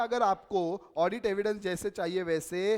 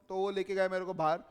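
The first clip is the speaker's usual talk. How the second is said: normal talking voice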